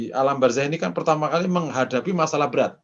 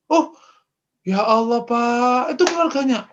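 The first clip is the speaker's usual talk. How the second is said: high, startled gasp of dismay; as if learning he jailed the VIP's family; shocked dismay; 10 semitones higher, 4 dB louder than usual. Acted. Police chief on the phone, startled and dismayed.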